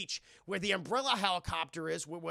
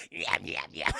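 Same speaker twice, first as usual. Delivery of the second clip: creepy creature murmur; 14 semitones lower, 4 dB louder than usual. Acted. Feces creature lurking in background.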